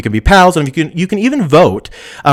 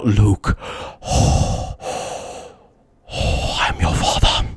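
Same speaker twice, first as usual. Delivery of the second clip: heavy breathing between whispered, drawn-out words; rasping breaths, then a slow voiceless murmur; Darth Vader; 5 semitones lower, 9 dB quieter, much slower than usual. Acted. Streamer quoting the famous movie villain, breathy whispered impression. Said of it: "he's also for saying".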